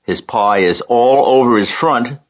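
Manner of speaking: high-pitched, storybook shout; narrator's contained shout, voice jumping up. A narrator's reading. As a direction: exclaim it high, tattling on someone. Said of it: "shouted Fern".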